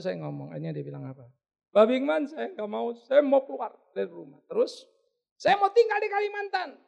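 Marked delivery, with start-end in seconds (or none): 1.7-6.7 high, agitated declaration, loud in bursts; mock upset caller. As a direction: imitate a wife dramatically threatening to leave. Upset caller announces leaving home. (menirukan istri merajuk dengan nada tinggi)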